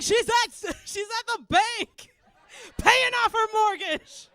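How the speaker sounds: high, loud, drawn-out taunt; mock menacing shout. Mother scaring son about tooth fairy, mock menacing shout. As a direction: shout it slowly like a threat. Parent shouting threat to child.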